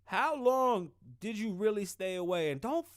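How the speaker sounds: loud, high call to the viewers. Real, not acted. Asking the dudes watching, called out loud.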